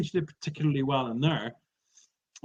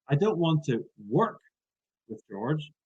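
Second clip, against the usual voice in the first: "work" lifted and set off by a pause; stress on WORK. Shown as a demonstration. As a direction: emphasise "work", pause, trail down. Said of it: "I change the emphasis".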